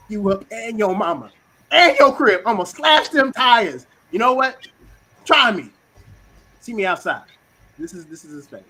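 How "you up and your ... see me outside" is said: loud, raised-pitch tirade of threats; mock threatening. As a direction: act out a spade squaring up for a fight. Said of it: "you threaten people".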